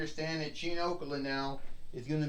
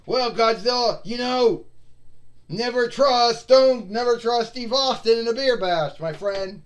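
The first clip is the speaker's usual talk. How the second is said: higher, louder brash wrestler gloat; gloating wrestler taunt; 7 semitones higher, 8 dB louder than usual. Acted. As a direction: rub it in his face. Taunting Godzilla after stunner.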